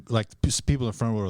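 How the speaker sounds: normal talking voice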